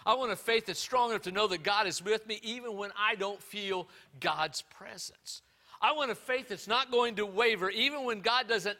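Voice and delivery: loud, high, fervent preaching; full-force preaching. Acted. Pastor preaching to his church, loud and fervent.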